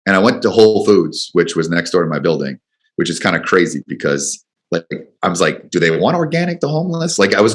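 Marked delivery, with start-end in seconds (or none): 5.7-7.2 higher, puzzled self-questioning; incredulous musing. Retold or imitated. Shopping for the homeless, he asks himself in a higher, incredulous voice. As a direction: ask it like the idea seems absurd. Wondering aloud while buying sandwiches.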